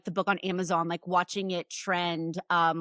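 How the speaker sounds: ordinary delivery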